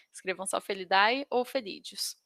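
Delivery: ordinary talk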